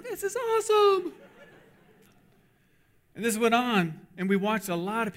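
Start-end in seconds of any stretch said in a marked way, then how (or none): 0.0-1.1 high-pitched gleeful exclamation; thrilled cheer. Retold or imitated. Cooks in the back cheering the free food, thrilled. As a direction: crow it like a delighted onlooker.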